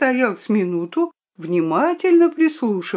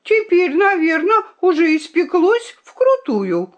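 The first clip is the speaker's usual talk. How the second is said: high-pitched, raised-voice jeer; taunting cry; 10 semitones higher, 4 dB louder than usual. A narrator's reading. Violinka says the egg must be baked, calling tauntingly.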